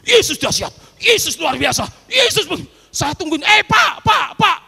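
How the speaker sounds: high, loud praise and calling; jubilant shouting. Retold or imitated. Father praising Jesus on the phone, high and loud. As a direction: keep shouting praise down the line.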